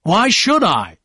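loud, high indignant retort; angry shout within narration. A narrator's reading. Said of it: "Tom shouted".

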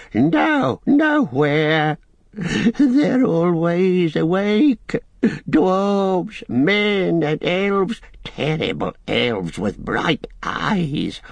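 high, slow, anguished creature wail; Gollum sobbing. Acted. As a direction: wail in terror, stretching the words. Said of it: "he sobbed in his throat".

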